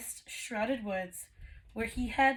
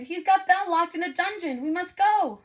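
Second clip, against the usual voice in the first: loud, high frantic plea; urgent, breathless pleading; 8 semitones higher, 7 dB louder than usual. A narrator's reading. Maurice begging the tavern crowd to rescue Belle, loud and frantic. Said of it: "he cried".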